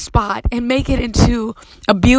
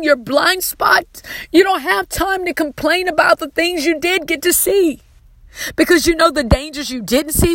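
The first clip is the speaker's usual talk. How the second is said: impassioned preaching; 7 semitones higher than usual. Acted. Preacher urging the congregation to be thankful, in impassioned preaching. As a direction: lift it up and stretch each word in praise. Preaching at full force on gratitude.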